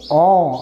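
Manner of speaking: "ऑ" as an open aw, not oh; phonic sound of O. Shown as a demonstration. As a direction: draw out "ऑ" as aw. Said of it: "ओचा उच्चार आहे".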